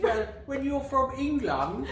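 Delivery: high, loud, slow hammed-up British accent. Shown as a demonstration. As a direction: exaggerate a high-pitched British accent for laughs. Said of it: "Honey, say something British".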